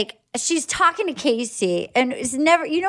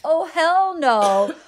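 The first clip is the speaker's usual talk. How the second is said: mock outrage; 4 semitones higher, much slower than usual. Her reaction to the dodged kiss.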